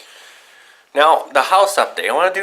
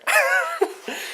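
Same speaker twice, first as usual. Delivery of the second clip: soft high laugh; 6 dB quieter than usual. Real, not acted. Relief about the gas.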